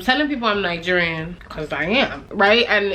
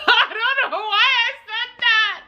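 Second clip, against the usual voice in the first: high squeal through laughter; embarrassed laughing; 14 semitones higher than usual. Real, not acted. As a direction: crack up at your own blunder, pitched way up. Laughing at her own blurted answer.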